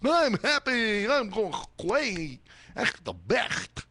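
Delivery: high, slow, put-on silly voice; drawn-out cartoonish mock voice; mock goofy voice. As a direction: ham up a dopey happy rider. Mocking a happy rider voice.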